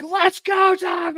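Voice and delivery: high, drawn-out challenge shouted at a dog; retold angry shout. Man with a bat challenging the neighbor's dog, angry shout. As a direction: re-enact yourself furiously taking on the dog. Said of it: "I was pissed".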